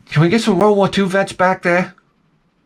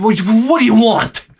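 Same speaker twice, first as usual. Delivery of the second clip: higher, louder, slow exasperated question; 4 semitones higher, 4 dB louder than usual. Retold or imitated. Husband fed up asking his wife for her order, exasperated. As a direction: demand an answer, fed up.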